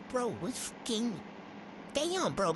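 usual speaking voice

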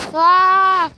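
sudden loud high yelp; startled yell. Real, not acted. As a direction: yelp out in surprise. Startled by the fan.

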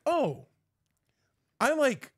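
high-pitched mock newcomer, hesitant; clueless normal person. Mocking normal people who hear "board games", clueless voice. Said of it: "the normal people are gonna be like".

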